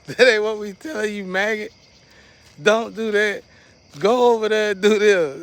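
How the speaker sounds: high, loud scolding; imitating angry ancestors giving commands; mad ancestors. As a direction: order someone around, loud and high-pitched. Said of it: "They get mad. They be like".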